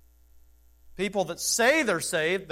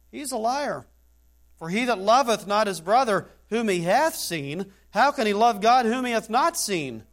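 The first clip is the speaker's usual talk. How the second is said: fervent preaching; 6 semitones higher, 8 dB louder than usual. Acted. Preaching scripture at full force.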